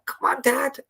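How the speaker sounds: high-pitched imitation of a child; young son cheering. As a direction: imitate a boy calling out before his voice broke. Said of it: "before his voice broke".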